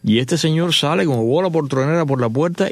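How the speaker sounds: ordinary talk, nothing marked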